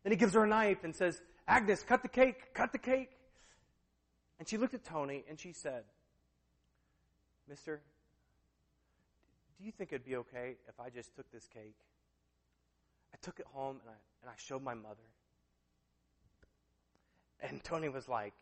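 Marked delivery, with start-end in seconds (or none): 1.5-3.2 high, loud, repeated command; retold holler, insistent; impatient urging. Hank urges her to cut. 7.5-15.1 soft, low, hesitant plea; retold meek, hushed question; timid request. Overwhelmed woman makes timid request.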